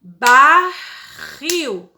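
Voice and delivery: teacher sounding out Barril syllable by syllable; split into syllables. Shown as a demonstration. Teacher to her class, spelling out a word in clapped syllables. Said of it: "Duas, ó".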